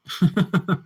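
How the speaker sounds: quick amused laugh; brief chuckle; short laugh. Real, not acted. Laughing at kids' remarks.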